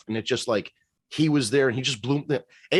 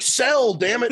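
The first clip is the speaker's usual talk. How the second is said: louder, high-pitched mock demand; mock wrestler's yell; 12 semitones higher, 4 dB louder, slower than usual. Wrestler stomping and demanding a sell, mock yell. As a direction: shout it like an exasperated wrestler. Imagined wrestler yells at baby.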